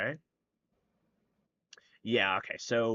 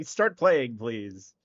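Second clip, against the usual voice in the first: slow, raised, loud command; exasperated call-out; 7 dB louder, much slower than usual. Real, not acted. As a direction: prod stalled players loudly. Urging players again.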